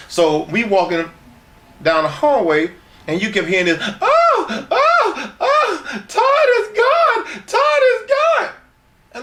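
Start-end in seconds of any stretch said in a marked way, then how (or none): 4.0-8.5 mock moaning woman. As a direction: ham up the woman shouting through the wall. Retelling woman's cries through wall.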